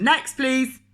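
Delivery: loud, high shouted next please; loud call. Acted. Grocer loudly calling the next customer. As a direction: call the next customer over his head.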